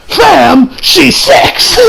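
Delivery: high, loud exclamation cracking toward laughter; mock outrage. Reviewer mock-scolds an anime character, mock outrage. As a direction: protest in disbelief, about to crack up.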